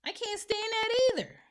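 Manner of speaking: very high, loud exasperation; exasperated squeal. Acted. Ranting about people who ask to ask, high exasperated squeal. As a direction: push it way up in exasperation.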